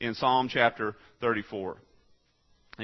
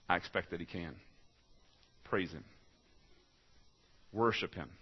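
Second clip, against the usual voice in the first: quiet; 6 semitones lower, 11 dB quieter than usual. Real, not acted. Quiet close after preaching.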